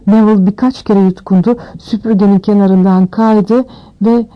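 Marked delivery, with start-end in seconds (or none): none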